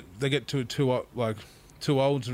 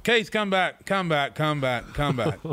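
retold call, high on the name; drawn-out summons to someone running off; Tomo; 3 semitones higher, slower than usual. Tomo calls Keith back a second time, calling out.

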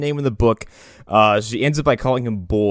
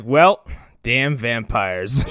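slow grumbling; much slower than usual. Retold or imitated. Mock quoting the doctor.

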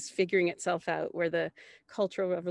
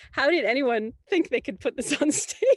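laughing disbelief; 7 semitones higher, 6 dB louder than usual. Retold or imitated. Recalls horror reading the script.

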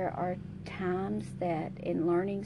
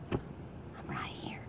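slow whisper, I'm right here; whispered reassurance; 12 dB quieter, slower than usual. Acted. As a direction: hush it close in the ear. God whispering presence.

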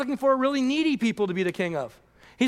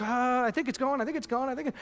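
anxious murmur; 6 dB quieter than usual. Pretend. Mock watching water boil.

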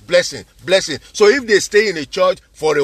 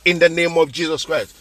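ordinary talk, nothing marked

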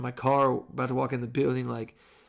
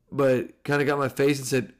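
normal talking voice

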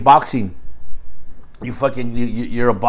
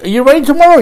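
excited; 10 dB louder, faster than usual. Real, not acted. Eager question to friend.

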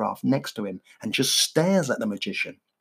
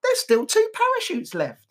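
high, slow Victorian street urchin; a much higher young child, slow; high child voice from the eighteen hundreds; 11 semitones higher than usual. Acted. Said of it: "a child from the eighteen hundreds".

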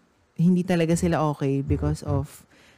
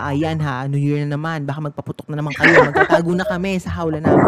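fast, laughing, louder mock dog voice; voicing the dogs; 4 dB louder, faster than usual. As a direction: rattle it off as the scared dogs, laughing. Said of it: "Sabi nila".

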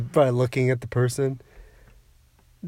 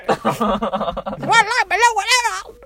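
squeaky silly voice; slower than usual. Pretend. Attempting silly voice.

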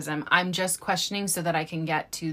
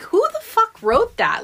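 loud, much higher indignant yell; outraged shout; 6 dB louder than usual. Retold or imitated. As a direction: yell it in disbelief. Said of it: "and I was like".

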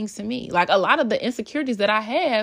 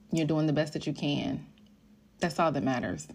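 usual speaking voice

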